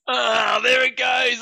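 high, loud eureka cry; excited shout. Real, not acted. Getting his co-host's kilt joke at last, loud shout. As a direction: blurt it out as the penny drops.

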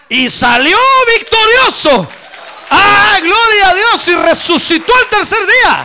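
high, full-force shout of victory; roaring pulpit climax; triumphant preaching shout. Real, not acted. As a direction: proclaim it at the top of your voice. Triumphant climax of the resurrection story.

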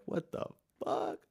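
breathy whispered "what the fuck"; half-asleep hushed disbelief; groggy whisper. Retold or imitated. Neighbours woken by the crash.

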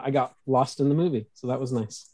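ordinary talk, nothing marked

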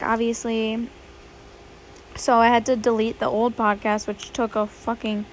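ordinary delivery

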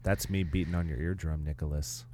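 soft, low creepy murmur; lightly sinister hush. Acted. Creepy whisper to listener.

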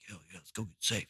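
very hushed, low reply; acted-out secretive murmur; furtive agreement. Furtive agreement to go smoke, retold.

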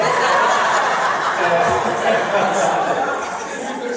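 big delighted laugh; hearty burst of laughter; loud laugh. Real, not acted. Crowd laughs at accent.

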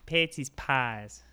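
put-on slow, drawn-out Chicago accent. Showing how Shameless characters talk, slow Chicago accent. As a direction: stretch the Chicago vowels. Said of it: "on Shameless, they're like".

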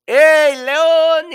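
high, loud hail; monkey calling the lion, high and loud. Acted. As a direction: shout across to the lion. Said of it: "le dice".

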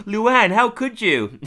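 drawn-out, high, loud mock dismay; mock outrage. Kirk confronting Luann over the DNA test, hammed-up hurt outrage. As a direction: wail it like a hammed-up betrayed husband.

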